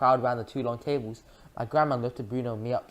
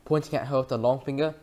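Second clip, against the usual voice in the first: ordinary delivery, nothing marked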